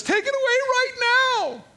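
very high, loud mock yell; hammed-up panicked shout; frantic demand. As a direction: cry out like a desperate ruler. Pharaoh demanding an end to plagues.

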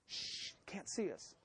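whispering, slow and hushed; hiding, whisper it slowly. Pretend. Adam whispering to Eve while hiding from God, hushed.

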